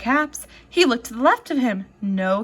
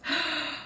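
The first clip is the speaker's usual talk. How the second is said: gasp; 8 dB quieter than usual. Acted. Peddler checking caps.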